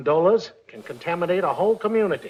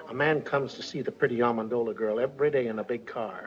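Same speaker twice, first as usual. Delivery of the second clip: low, soft gossip; too quiet to hear; 5 semitones lower, 6 dB quieter than usual. Acted. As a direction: confide it softly, under the room's hearing. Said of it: "We can't hear you".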